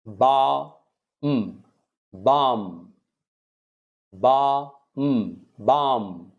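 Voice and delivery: "balm" split into bah and mm, then joined; silent L. Shown as a demonstration. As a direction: sound out bah-mm, no L. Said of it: "L is silent".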